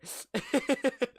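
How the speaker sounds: giggle. Real, not acted. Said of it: "I'm sorry".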